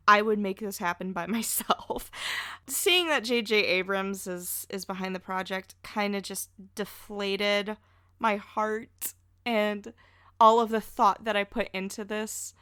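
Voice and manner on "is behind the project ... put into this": normal talking voice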